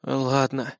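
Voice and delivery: soft, grudging surrender; softly sighed agreement; resigned sigh. A narrator's reading. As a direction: give in with a quiet sigh. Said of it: "вздохнул Лиам".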